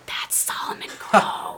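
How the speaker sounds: slow, hushed whisper of a starstruck fan; whispered, drawn-out revelation; awed whisper. Retold or imitated. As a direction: whisper the name in awe. Awed aside to wrestler's friend.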